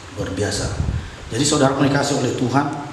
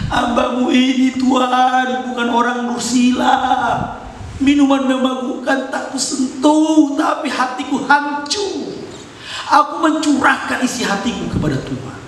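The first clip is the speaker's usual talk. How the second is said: much higher, half-sung, slowing on the heartbroken lines; grieving plea; 13 semitones higher, 4 dB louder than usual. Acted. Hana answering the priest that she only poured out her heart, grieving. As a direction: protest her innocence through tears. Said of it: "Tapi Hana mengatakan".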